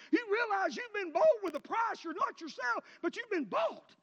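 loud, high preacher's cry; full-force preaching. Acted. Preacher thundering at his congregation, loud and high. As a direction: drive it home to the congregation.

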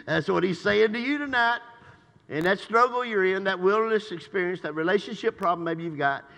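loud, high, fervent preaching shout; full-force preaching. Acted. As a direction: preach it out with everything you have. Preaching builds again.

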